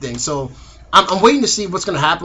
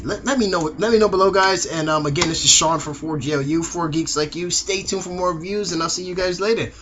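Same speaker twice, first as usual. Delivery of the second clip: normal talking voice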